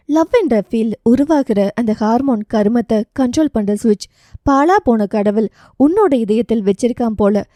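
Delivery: ordinary delivery, nothing marked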